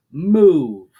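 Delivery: loud barked order to march; shouted command. Acted. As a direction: snap the crowd into motion.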